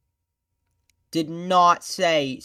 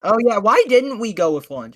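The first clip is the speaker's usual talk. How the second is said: surprised; 7 semitones higher, 10 dB louder than usual. Real, not acted. Sudden realization.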